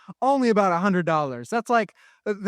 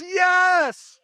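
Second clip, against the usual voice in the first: joyful shout; 9 semitones higher than usual. Acted. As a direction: shout yes in sheer excitement. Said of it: "He shouts".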